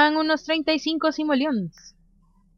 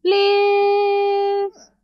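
pleading please; 9 semitones higher, 3 dB louder than usual. Acted. Begging Sim to read.

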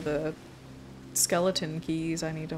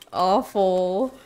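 normal talking voice

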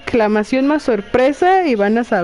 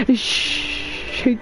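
long voiceless shush; soft playful hush; hushing shh; 6 dB quieter than usual. Acted. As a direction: shush someone as if finger to lips. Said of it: "le pone el dedo en la boca y le hace".

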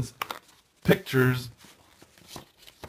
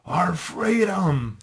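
higher, drawn-out quoted war cry; movie battle cry; 8 semitones higher, much slower than usual. Acted. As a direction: call it out like a hammed-up film rallying cry. Acting out Braveheart's famous battle line.

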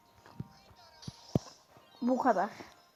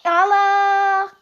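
a sudden high, very loud exclamation; startled shout; 8 semitones higher, 12 dB louder than usual. Real, not acted. Player caught off guard in the match, startled loud cry. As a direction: shriek at the shock in the game.

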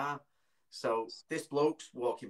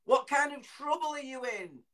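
high, raised call; concerned rescuer; 12 semitones higher, 5 dB louder than usual. Retold or imitated. Bloke questioning hidden child, imitated urgent high call. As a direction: imitate a stranger calling out to a child. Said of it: "And he's gone".

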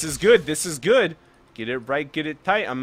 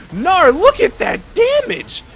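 high, loud, drawn-out exclamation; excited shout; 9 dB louder, slower than usual. Real, not acted. Player marveling at damage in a fight, excited shout. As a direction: yell it out in amazement. Excited live play call.

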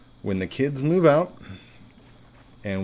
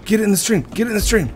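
high, loud urging; will the ball into the stream; excited urging; 10 semitones higher, 4 dB louder, faster than usual. Real, not acted. Gamer willing the ball into the stream, excited urging.

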